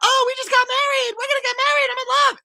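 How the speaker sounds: very high, giddy mock Anna voice; Anna quote. Brother mocks Anna gushing over her fiancé, in a very high giddy voice. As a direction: squeal it like a lovestruck girl. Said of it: "she's like".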